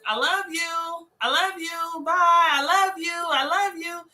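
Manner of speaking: slow, gentle, affectionate repetition; tender I love you. Retold or imitated. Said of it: "what I do more of now is".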